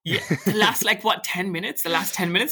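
laughing. Real, not acted. Laughing while recalling film.

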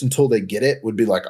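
ordinary talk

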